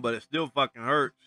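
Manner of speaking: usual speaking voice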